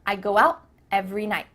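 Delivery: quick, unreleased t in "out", "night"; fast casual model sentence; stopped T. Shown as a demonstration. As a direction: run it briskly, cutting t in "night". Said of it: "it's just stopped".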